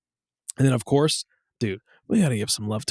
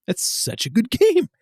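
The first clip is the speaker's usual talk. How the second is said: much higher-pitched, laughing enthusiasm; gushing praise; 9 semitones higher than usual. Real, not acted. Fan praising Castlevania Legacy of Darkness, gushing. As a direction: gush it, voice climbing into a laugh.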